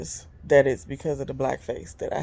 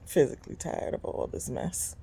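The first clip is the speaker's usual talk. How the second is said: tired sigh; 2 semitones lower, 7 dB quieter than usual. Real, not acted. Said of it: "I'm tired".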